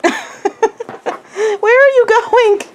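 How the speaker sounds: high, drawn-out puppy talk; stretched, high-pitched teasing question; playful pet talk. Real, not acted. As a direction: coo it high and slow to a puppy.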